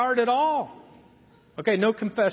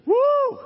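whooping woo; 5 dB louder than usual. Acted. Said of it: "They were hot".

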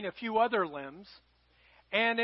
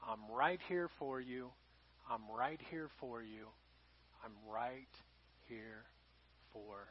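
God reassuring the listener in a much softer, low voice; tender God's voice; 11 semitones lower, 17 dB quieter than usual. Acted. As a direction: speak it softly, like a gentle whisper of comfort. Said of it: "And he said".

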